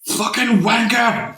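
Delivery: put-on slow, high Scottish accent; Scottish swearing. Mimics angry Billy swearing, hammed-up Scottish accent. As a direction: spit out a mock Scottish curse. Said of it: "when Billy gets angry".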